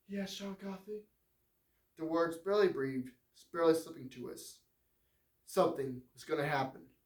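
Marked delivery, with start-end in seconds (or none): 0.1-1.1 higher, lighter woman's voice; frail woman. Acted. Dying warden's wife asks John about his scars, high and gentle. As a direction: ask it gently, a sick woman wondering.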